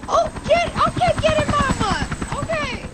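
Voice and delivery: high, loud squeal of encouragement; excited cheering. Real, not acted. Cheering someone on mid-story, excited squeal. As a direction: whoop them on in a high-pitched voice.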